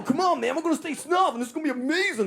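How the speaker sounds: hammed-up enthusiastic pal; Des Bishop impression. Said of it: "And Des go".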